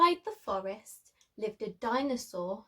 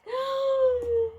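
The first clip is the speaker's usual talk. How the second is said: sharp high gasp of fright; storyteller's startled gasp; frightened gasp; 12 semitones higher, 4 dB louder than usual. A narrator's reading. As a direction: gasp as if you saw the footprints. Sid frightened by footprints.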